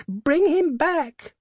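very high, loud, drawn-out demand; crying out. A narrator's reading. Old woman demanding her son back, crying out. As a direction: wail the command at full pitch. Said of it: "cried the old woman".

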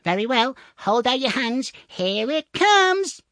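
very high, loud call, last word stretched; shouting tiny man. A narrator's reading. Tom throws money to the thieves, shouting high. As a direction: holler high, building to a drawn-out finish. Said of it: "called out as loud as he could".

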